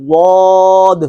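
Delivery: واد said slowly with the tongue sides on the molars; slow model dad. Shown as a demonstration. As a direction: give the ضاد carefully. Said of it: "تو یہ اس کا مخرج ہے".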